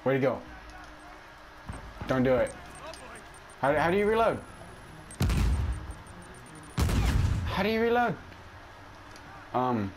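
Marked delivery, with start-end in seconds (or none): 3.6-8.1 high, panicked question; desperate, searching for the button; frantic high question. Real, not acted. Frantic in a firefight.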